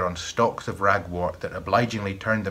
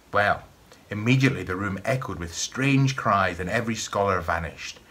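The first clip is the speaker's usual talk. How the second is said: usual speaking voice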